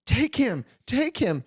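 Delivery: high, urgent repeated plea; frantic pleading. Retold or imitated. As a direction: beg her to grab the baby. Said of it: "I'm screaming bloody murder".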